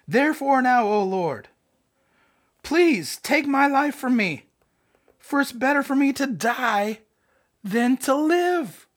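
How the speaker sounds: hammed-up wail, high and drawn out; drama tantrum. Jonah begging God to end his life, mock drama tantrum. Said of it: "Jonah put on a full drama tantrum".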